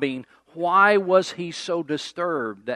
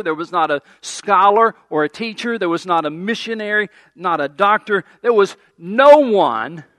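ringing, rhythmic pulpit cadence, louder and higher; preaching at full force; 4 semitones higher, 6 dB louder than usual. Acted. Pastor preaching to the congregation, loud and rising in fervor. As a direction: hammer each "there was not" like a preacher in full cry.

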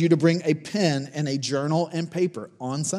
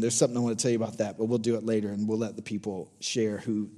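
normal talking voice

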